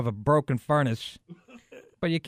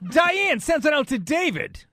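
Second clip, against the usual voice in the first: radio DJ voice; 11 semitones higher, 3 dB louder than usual. Pretend. Casey Kasem style dedication.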